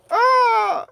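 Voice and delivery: piercing high mock shriek; hammed-up squeal of disgust; disgusted scream. Disgusted by the boogers.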